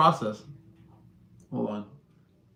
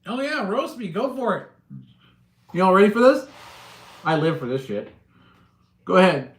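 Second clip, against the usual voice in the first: higher and louder, daring them to bring it on; hyped challenge; 7 semitones higher, 10 dB louder, faster than usual. Real, not acted. Streamer daring his chat to roast him, hyped and loud. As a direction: pump yourself up and egg the crowd on.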